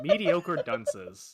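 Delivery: savoring slowly. Shown as a demonstration. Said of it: "repeating for effect".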